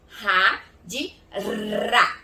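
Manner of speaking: breathy first "Ra", then drawn-out trilled "ra"; contrasting R sounds. Shown as a demonstration. Teacher contrasting two sounds for pupils, R contrast. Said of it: "vocês vão falar ra e ra".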